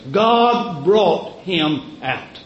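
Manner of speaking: slow, God pressed hard; emphatic quote. Acted. Preacher quoting Nehemiah, stressing God. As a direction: weigh every word, God first. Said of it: "Nehemiah says it like this".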